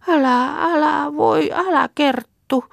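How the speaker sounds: high, slow plaintive plea; distressed pleading. Acted. Sick Martta begging Kerttu to stop, distressed pleading. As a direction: plead weakly in a high, drawn-out voice.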